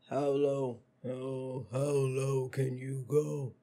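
slow, sinking deep voice; going low. Shown as a demonstration. Showing how low she can go, deep voice. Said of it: "I can go high, but how low".

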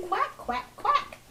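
high, playful quacks; duck calling. A narrator's reading. Mother reads Mister Duck calling his chick, high quacks. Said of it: "He calls to his little chick".

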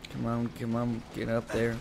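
normal talking voice

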